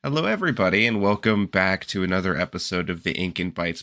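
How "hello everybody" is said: normal talking voice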